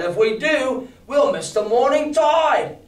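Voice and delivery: high, slow shout about missing the tide; crying out. Acted. Long John protesting to the captain, high slow shout. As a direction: call it out, pitch rising and slowing. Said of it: "cried Long John".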